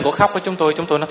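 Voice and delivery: usual speaking voice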